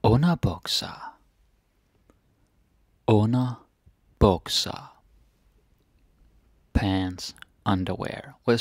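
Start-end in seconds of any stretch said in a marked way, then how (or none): 3.1-4.9 word drawn out slowly in parts; slow, segmented pronunciation demo; slow teaching repetition. Shown as a demonstration. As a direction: model it slowly for learners, splitting the compound.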